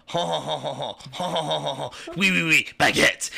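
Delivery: mock French laugh ending in oui oui baguette. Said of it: "And it says".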